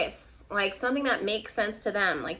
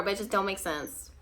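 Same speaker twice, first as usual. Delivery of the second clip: usual speaking voice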